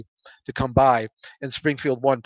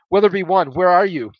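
urgent call over the radio; tense radio call; 5 semitones higher, 9 dB louder than usual. Acted.

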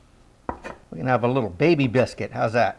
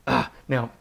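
ordinary talk, nothing marked

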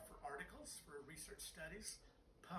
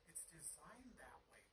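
whispered aside; 12 dB quieter than usual. Real, not acted. Whispered aside while explaining anatomy.

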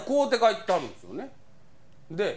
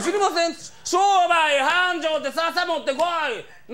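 mock eager answer; 10 semitones higher, 4 dB louder than usual. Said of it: "って言われて".

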